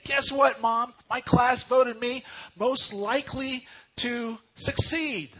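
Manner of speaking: put-on high, loud, beaming child's voice; excited little girl. Daughter telling mom her class news, high and excited. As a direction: squeal it out like a proud schoolgirl. Girl's excited quote in story.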